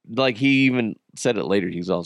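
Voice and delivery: normal talking voice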